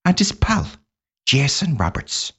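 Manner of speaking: a lilting Irish brogue for the show intro; Irish accent. Pretend. Host opens the podcast episode in an Irish accent. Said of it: "the Irish one was 'cause I lived in Ireland".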